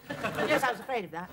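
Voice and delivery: talking around a sweet; words blurred by a wine gum; mouth full. Acted. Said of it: "Have you got something in your mouth?".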